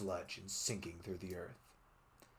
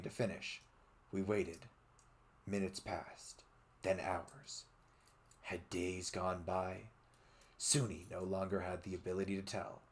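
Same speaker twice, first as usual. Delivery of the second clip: quiet narration; 3 semitones lower, 9 dB quieter than usual. A narrator's reading. Narrating long waiting.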